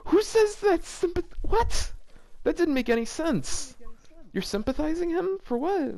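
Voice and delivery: high, disbelieving squeal of confusion; baffled shriek at the word choice; incredulous. Real, not acted. Reacting to a fanfic line, high disbelieving squeal.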